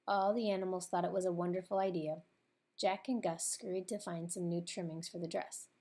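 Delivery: usual speaking voice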